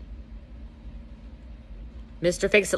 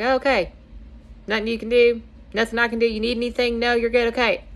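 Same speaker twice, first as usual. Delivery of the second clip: lightly voiced agreeable husband, higher pitch; a higher, easygoing "okay then" husband; husband backing off; 5 semitones higher, faster than usual. Husband told it's just an off day, lightly voiced agreeable reply.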